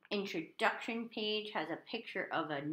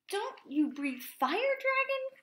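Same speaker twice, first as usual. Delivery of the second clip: high voice rising higher; squeaky knight; slower than usual. A narrator's reading. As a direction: ask in disbelief as a squeaky knight. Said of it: "squeaked a thin, shiny knight".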